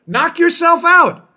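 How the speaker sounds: mock the spies' shrug, drawn out; sarcastic permission. Host mocking spy agencies, slow sarcastic high shout. Mocking the government's spying logic.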